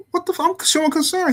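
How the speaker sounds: high-pitched retold indignation; indignant protest. Son reacting to his father snapping on him, indignant. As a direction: protest like a misunderstood kid.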